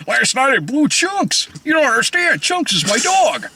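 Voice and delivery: a touch of put-on voice for the joke. Telling a joke punchline.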